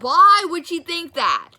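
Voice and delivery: high, loud, slow incredulous why; replaying her stunned outrage; shocked disbelief. Retelling the lie to her friend, loud, high disbelief.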